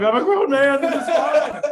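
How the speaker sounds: high, laughing voice, half crying, half amused; teary laughing protest. Retold or imitated. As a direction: play a mock tough guy through tears and laughter. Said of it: "like, tearing up".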